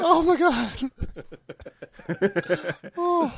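raspy after a coughing fit; rough voice after a smoker's cough; smoker's rasp. Real, not acted. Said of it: "Victor just developed a smoker's cough".

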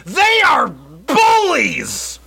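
loud, high, stretched outcry; outraged slow shout. Acted. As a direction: wail it like a wronged customer. Mock outraged review reading.

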